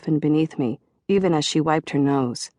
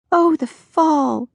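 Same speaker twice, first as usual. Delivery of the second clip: high, slow, dreamy munchkin voice; slightly munchkin voice; 11 semitones higher, 5 dB louder, much slower than usual. A narrator's reading. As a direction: sigh over autumn like a tiny munchkin. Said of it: "in her slightly munchkin voice".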